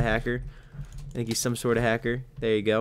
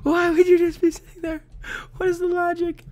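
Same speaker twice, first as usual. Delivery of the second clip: high-pitched wail of disbelief; incredulous squeal; 13 semitones higher, 7 dB louder than usual. Real, not acted. Player baffled by an ambusher, high incredulous squeal.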